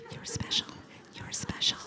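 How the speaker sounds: barely audible whisper. Shown as a demonstration. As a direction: breathe it almost silently. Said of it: "whispered affirmation repeated".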